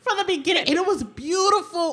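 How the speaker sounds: gushing over the plant's story; excited gushing. Real, not acted. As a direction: gush about it.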